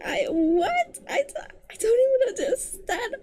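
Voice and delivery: high, cracking laugh-cry of disbelief; baffled laugh. Real, not acted. Reviewer laughing in disbelief at the plot twist. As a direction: laugh in helpless confusion.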